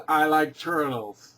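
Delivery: put-on slow, dim-witted kid voice; dopey meme voice. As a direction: drag it out like the turtle kid.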